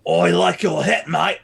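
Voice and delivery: shout-out in a full Australian accent. Shown as a demonstration. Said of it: "for Australia".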